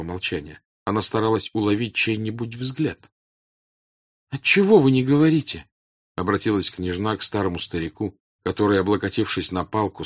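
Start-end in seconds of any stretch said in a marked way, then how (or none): 4.3-5.7 high, loud questioning; puzzled princess. Acted. Princess questions the silent crowd, puzzled and raised. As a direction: press them for a reason, voice raised.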